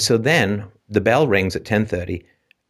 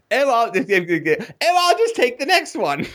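much higher, slow, teasing parody of the airy refusal; mocking imitation; 12 semitones higher, slower than usual. Classmates teasing him later at baseball, mocking parody. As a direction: parrot him mockingly, drawn out. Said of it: "You know, like".

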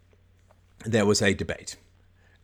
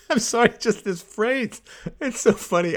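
real helpless amusement pushing the voice up; cracking up; 10 semitones higher than usual. Real, not acted. Can't keep a straight face at a phrase, cracking up. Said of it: "how to do this with a straight face".

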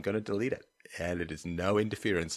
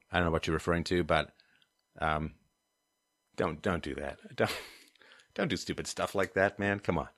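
usual speaking voice

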